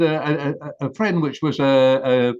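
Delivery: ordinary delivery, nothing marked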